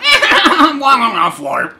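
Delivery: high laughing flirt; flirty laugh. Acted. Characters giggling as they decide to flirt. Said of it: "they just are like".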